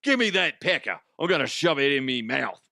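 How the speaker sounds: exaggerated Cockney accent. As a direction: ham up a crude Cockney come-on. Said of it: "But Cockney".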